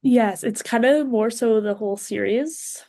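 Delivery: ordinary talk, nothing marked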